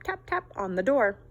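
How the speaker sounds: usual speaking voice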